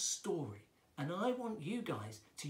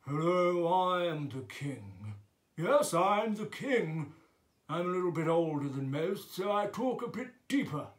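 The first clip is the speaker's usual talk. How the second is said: deep-voiced king; 6 dB louder than usual. Shown as a demonstration. Teacher demonstrating the king for children, deep regal voice. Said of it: "So our king would talk like this".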